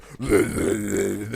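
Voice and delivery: rolling, rumbling dubstep growl made by mouth; imitated dubstep noise. Mocking what first-time dubstep listeners heard, imitated growl. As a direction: mimic the wobbling bass noise. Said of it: "they just heard the".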